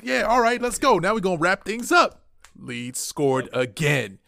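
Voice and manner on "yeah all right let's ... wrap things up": higher, louder hyped-up confidence; rally yourself, sure the win is coming; retold excitement. Fan retelling his premature celebration, excited.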